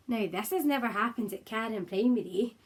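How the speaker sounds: plain reading voice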